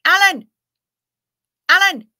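loud repeated shouts of the name; playful shouted call; calling out Alan. Pretend. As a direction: yell the name across the room, again and again. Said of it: "I know that Hef is gonna understand when I go".

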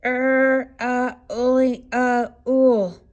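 ordinary delivery, nothing marked

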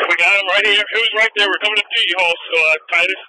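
keyed up, closing in on the suspect; excited. Real, not acted.

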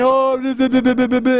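dismissive blah blah mimicry; mock ranting. Preacher mimics immature believers' whining, mocking. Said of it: "ranting and raving as we do".

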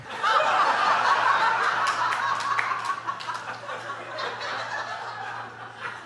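sustained airy mocking cackle; long high wheezing laugh. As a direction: laugh on and on at the thought. Mocking the cynic's glee.

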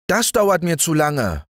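put-on high, impatient child voice; impatient little boy. Little Häwelmann to the rooster, impatient high child voice. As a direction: whine it like a bored small boy. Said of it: "sagte Häwelmann".

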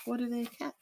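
plain reading voice

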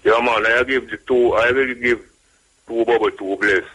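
ordinary talk, nothing marked

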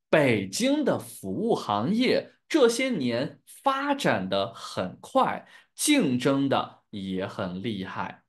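deliberate, slow read-aloud for learners; slow model reading. Shown as a demonstration. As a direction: spell out the sentence phrase by phrase, slowly. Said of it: "one more time this sentence". (放慢速度领读)

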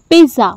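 पिज़्ज़ा said with a plain z sound, "piza"; पिज़्ज़ा as most people wrongly say it, "piza"; wrong pizza. Shown as a demonstration. Teacher showing the wrong way to say pizza. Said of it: "is it right?".

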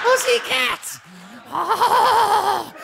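a wild puppet wolf yelling; high puppet voice. Acted.

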